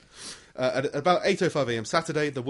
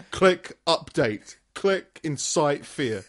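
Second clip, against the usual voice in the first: slow, deliberate menu options; slow menu reading; much slower than usual. Retold or imitated. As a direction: read each option slowly, deadpan.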